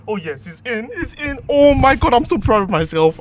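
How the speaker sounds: high, loud triumphant cheer; excited shout. Real, not acted. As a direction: celebrate loudly. Spark plug finally in.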